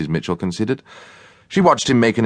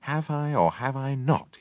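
soft, slow, higher mutter; 9 semitones higher, 8 dB quieter than usual. A narrator's reading. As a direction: mutter it crossly under the breath. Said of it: "he muttered".

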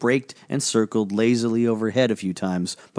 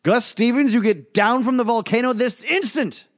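much higher, sharp parental command; stern order; 13 semitones higher than usual. Acted. Magician ordering Gus off the volcano, stern. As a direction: scold him down off the mountain at once. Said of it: "the magician ordered sternly".

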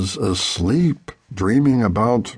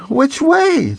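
much higher, urgent question; scared boy asking over the radio, much higher; anxious question. A narrator's reading. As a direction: ask in alarm over the radio. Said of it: "the nephew asked again".

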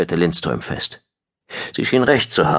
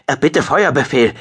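higher-pitched, pressing com request; urgent military request; 8 semitones higher than usual. A narrator's reading. Pilot asking for the order to fire, urgent. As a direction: press the commander for a decision.

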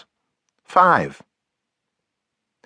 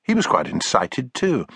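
ordinary delivery

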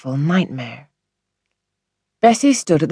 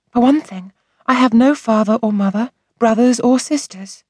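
put-on higher childlike voice, unhappy; upset young girl; 7 semitones higher than usual. A narrator's reading. Orphaned girl telling the doctor her troubles, sad child voice. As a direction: speak as a sad little girl.

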